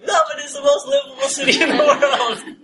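high, loud, laughing sales pitch; mock-insistent laughing boast. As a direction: sell the city through a laugh. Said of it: "I kept repeating".

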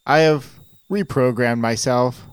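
a machine proudly showing off its new happiness; joyful robot. Acted. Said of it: "hear the joy in my voice".

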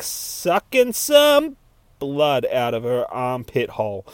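usual speaking voice

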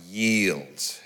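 a soft, falling "Yield.". Shown as a demonstration. Preacher urging the congregation to surrender, soft. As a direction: breathe "Yield." gently. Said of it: "Say yes".

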